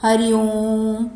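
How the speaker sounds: ओम and Hariom held long and slow; slow, long demo of the prolonged vowel in ओम; drawn-out ओम. Shown as a demonstration. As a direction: stretch ओम out to show a prolonged vowel. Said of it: "ओम का चिन्ह".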